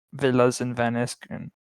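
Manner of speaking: normal talking voice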